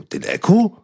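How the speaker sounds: high, incredulous echo of the name; a retold, disbelieving question, pitched high; surprised. [name] surprised that the rapper brings [name], high incredulous question.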